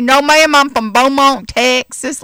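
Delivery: a hint of the driver's drawl; Texas drawl. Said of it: "he talked like this".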